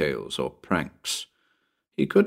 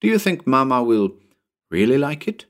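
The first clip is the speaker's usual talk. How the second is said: put-on higher, hopeful boy's question; appealing child; 5 semitones higher than usual. Tommy asks about Mama and the tail, appealing. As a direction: plead lightly, rising at the end. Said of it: "He looked up appealingly".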